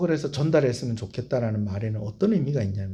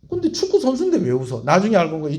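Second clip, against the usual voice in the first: normal talking voice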